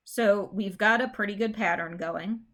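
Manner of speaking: ordinary talk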